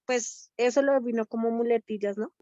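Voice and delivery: ordinary talk, nothing marked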